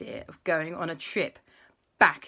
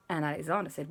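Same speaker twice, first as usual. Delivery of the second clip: ordinary delivery, nothing marked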